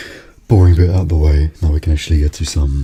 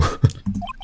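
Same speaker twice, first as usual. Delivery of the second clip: villain cackle; 6 dB quieter than usual. Acted. Villain gloats over victim.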